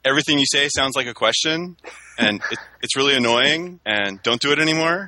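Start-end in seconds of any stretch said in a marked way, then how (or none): 0.0-1.8 "everything you say sounds like a question" rising at the end like a question; rising question tune. Real, not acted. Complaining about uptalk to friends, with a rising tune. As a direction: let "question" lift up as if asking. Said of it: "Like you just did?".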